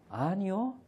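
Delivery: put-on high little-child "no"; child's answer. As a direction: answer like a small kid. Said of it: "유치부 아이들이 대답합니다".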